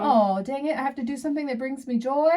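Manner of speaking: sarcastic fake groan; mock complaint. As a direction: whine it like a chore. Mock complaint about doing joyful things.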